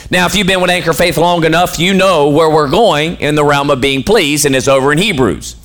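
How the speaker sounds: ordinary delivery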